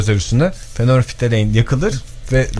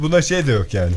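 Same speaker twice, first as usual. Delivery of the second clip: ordinary talk, nothing marked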